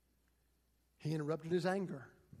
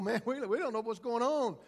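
bewildered onlookers; 5 semitones higher, 5 dB louder than usual. Retold or imitated. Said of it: "They go".

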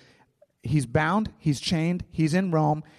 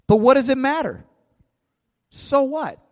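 emphatic dismissal; 10 semitones higher, 6 dB louder than usual. Retold or imitated. Reads Paul shrugging off rivals' motives.

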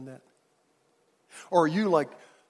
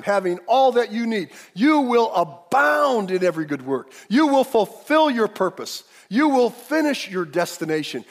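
full-force preaching; 10 semitones higher, 10 dB louder than usual. Acted. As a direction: preach it out to the whole church, stretching the big words.

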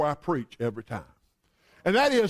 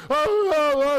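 louder, high imitated bawling; mock child's wail; 7 semitones higher, 5 dB louder, slower than usual. Little boy in the car, hammed-up wailing. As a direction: bawl like a little boy in the back seat. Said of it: "And the little boy there".

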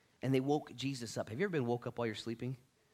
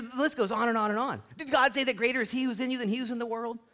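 normal talking voice

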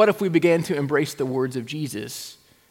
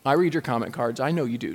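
usual speaking voice

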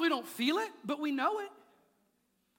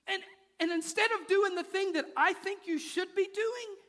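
ordinary talk, nothing marked

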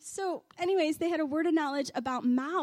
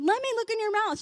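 high, eager retold demand; insistent friend; 8 semitones higher, 4 dB louder, faster than usual. Aunt's friend asking to check mom's mouth, insistent. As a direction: act out an insistent request, pitched up high. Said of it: "my aunt and her friend said".

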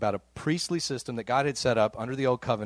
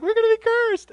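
very high, loud imitation of a panicked wail; hammed-up tearful freak-out; mock crying wail; 8 dB louder than usual. Husband imitates his wife freaking out about tithing, mock crying wail. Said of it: "She started crying. She's like".

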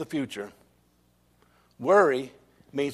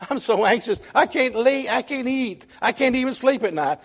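high-pitched pretend wail of an anxious child; mock weeping; 8 semitones higher than usual. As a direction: play a child fretting tearfully, pitched way up. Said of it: "and just weeping and said".

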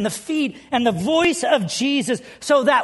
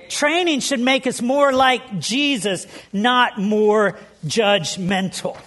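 slow emphatic repeat; slower than usual. Acted.

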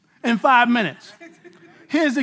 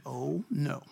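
much softer and lower, dismayed; a small hushed no; Peter's hushed protest; 9 semitones lower, 13 dB quieter than usual. Retold or imitated. Peter quietly objecting to Jesus saying he will die. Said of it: "Peter said".

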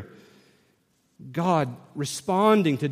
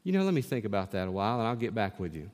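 low mumbled reply; 10 semitones lower, 11 dB quieter, faster than usual. Pretend. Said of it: "And I'll say to you".

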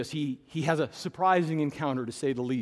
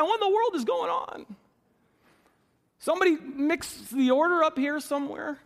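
pitched way up, a touch louder, exaggerated puzzlement; mock bewilderment; 9 semitones higher, 3 dB louder than usual. Preacher to congregation, hammed-up high confusion. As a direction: play baffled, as if the page is out of order.

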